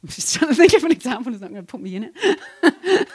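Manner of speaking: words bubbling with real laughter; giggling through the sentence; laughing. Real, not acted. Laughs at her own caution.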